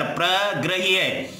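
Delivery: प्रग्रह्य drawn out part by part; प्रग्रह्य at careful teaching pace; slow syllable breakdown. Shown as a demonstration. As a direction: split प्रग्रह्य slowly into प्र and ग्रह्य. Teacher repeats the word broken up.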